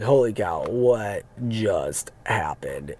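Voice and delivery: low, slow stunned aside; stunned disbelief. Retold or imitated. Driver alone once they got out, slow stunned disbelief. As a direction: let it sink in slowly, low. Retold reaction after they left.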